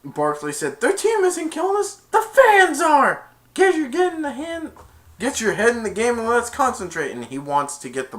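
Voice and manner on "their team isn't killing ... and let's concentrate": high, loud team scolding; rallying shout. Retold or imitated. Barkley rallying his team, loud and urgent. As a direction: yell at teammates to focus. Retells player's rousing rebuke.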